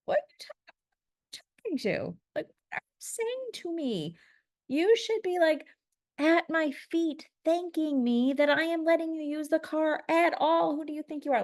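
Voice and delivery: high-pitched, incredulous indignant rant; acted-out rage. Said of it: "like, rage".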